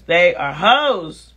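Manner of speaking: ordinary talk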